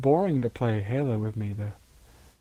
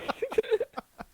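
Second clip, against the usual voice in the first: high-pitched giggle fit; high giddy laugh; 5 dB quieter than usual. Real, not acted. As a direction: burst into squeaky giggles. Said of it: "'cause it's funny".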